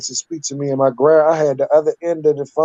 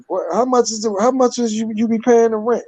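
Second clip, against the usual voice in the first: defiant challenge; 7 semitones higher than usual. Retold or imitated. Teen challenges grandma.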